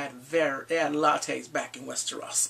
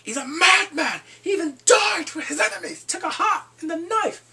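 high, shouted mock bragging about a hero; loud drunken-style boasting; 12 semitones higher, 9 dB louder than usual. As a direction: bellow the praise, hammering key words. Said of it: "he is boasting him".

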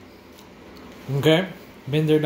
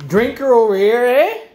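higher, louder bragging call; proud show-off; 9 semitones higher, 7 dB louder than usual. Real, not acted. Showing the boys his trusty drinker, loud and high.

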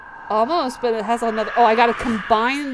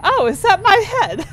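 high, louder shocked plea; shocked pleading; 9 semitones higher, 4 dB louder than usual. Real, not acted. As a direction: beg in a squeaky panic. Pleading with game creature.

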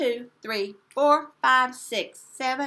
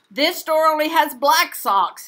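put-on louder, higher whiny girl; Tina complaining; 5 semitones higher, 11 dB louder than usual. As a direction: whine it like a fed-up kid. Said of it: "said Tina".

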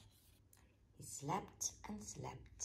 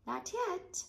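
giggled; 6 dB louder than usual. A narrator's reading. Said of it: "Rabbit giggled".

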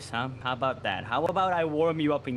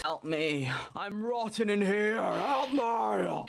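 mock plea for help; 7 semitones higher, slower than usual.

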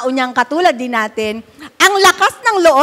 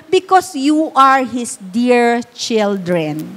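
slow scripture reading; 3 semitones lower, much slower than usual. Acted. Slowly reading the verse.

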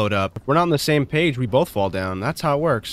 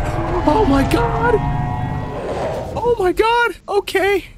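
very high, loud cry easing down gradually; shriek, then talk yourself down in a high voice; shocked shriek, settling; 4 dB louder than usual. Real, not acted.

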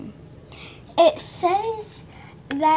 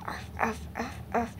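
soft puppy barks; 6 semitones lower, 14 dB quieter, faster than usual. Pretend. Child voicing toy dog.

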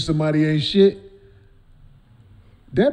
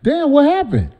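What happened? pitched much higher in mock curiosity; curious damn; 10 semitones higher than usual. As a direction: pop it up high, nosy and intrigued. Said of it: "I know to be like".

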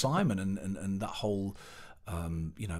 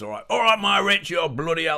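much higher and louder, a brash blokey mentor imitated; no-nonsense bloke; 8 semitones higher, 9 dB louder than usual. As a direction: belt it out like a gruff panto boss comforting a crying lad. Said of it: "he was a big no-nonsense man".

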